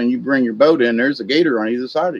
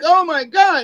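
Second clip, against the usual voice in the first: high, gasping disbelief; imitating stunned bystanders; mock shock; 13 semitones higher, 3 dB louder than usual. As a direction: react like you just saw the dog vanish. Shocked onlookers after dog eaten.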